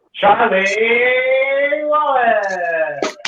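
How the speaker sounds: name drawn out high, sliding down; MC introduction. Real, not acted. Host brings the comedian on for Dundee, drawn-out announcement. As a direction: announce the act with a long-held name.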